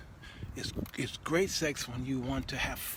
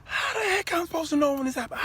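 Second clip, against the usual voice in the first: high, loud, fast indignant comeback; wife's retort; 6 dB louder, faster than usual. Retold or imitated. The mama fires back at her husband, high, loud and fast. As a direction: snap back high and quick. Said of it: "She like".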